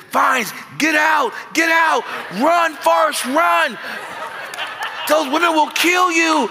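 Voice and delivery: high, loud panicked yelling; frantic shout. Retold or imitated. Pastor phoning to warn him, shouting high and loud. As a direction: yell at him to run for his life. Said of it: "my pastor called me and said".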